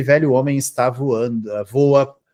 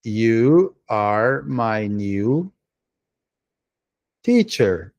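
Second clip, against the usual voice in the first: 1 awkward slow read; 3 semitones lower, much slower than usual. Shown as a demonstration. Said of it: "Ficou feio esse "you" aqui".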